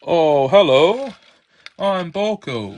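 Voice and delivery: a loud, slow hello in a put-on engine voice; a loud new character voice for the diesel engine; new Boko voice. A toy diesel greets his friends in a loud new voice. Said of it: "I don't know why my voice has suddenly changed".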